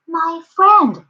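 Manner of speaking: high little voice; a small being calling up, pitched high; tiny speck voice. A narrator's reading. Tiny mayor hailing the elephant, high little voice. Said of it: "came the voice".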